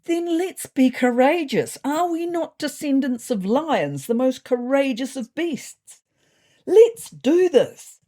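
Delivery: high, loud, stretched-out call to arms; rally the troops, building to a big finish; rousing rally cry. Acted. Cat leader to his cabinet, rousing them to courage.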